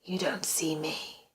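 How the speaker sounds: slow, secretive hush; menacing whisper. A narrator's reading. Stalker to victim who cannot see him, menacing whisper. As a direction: whisper it slowly, knowingly.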